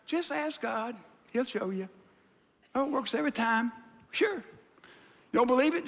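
ordinary delivery

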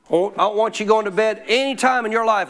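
ordinary talk, nothing marked

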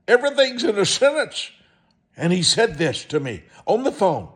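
ordinary delivery

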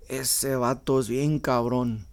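slow Mexican Spanish "Ese vato es bien cabron"; Spanish phrase demo. Shown as a demonstration. Showing listeners the badass meaning, slow Spanish "Ese vato es bien cabron". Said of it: "you can say".

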